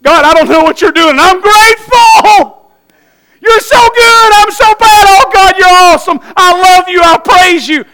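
freed believer praising God at full voice; ecstatic praise. Acted. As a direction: pour it out in gratitude. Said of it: "and you go".

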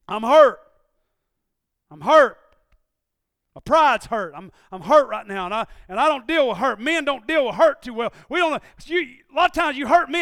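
loud, high confession in the pulpit; preaching at full force. Acted. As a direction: cry out the hurt to the congregation.

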